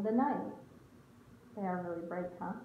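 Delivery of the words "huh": ordinary delivery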